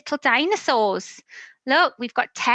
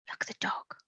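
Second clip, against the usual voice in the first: whisper; 14 dB quieter than usual. Acted. Hushed aside to children.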